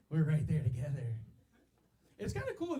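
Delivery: ordinary talk, nothing marked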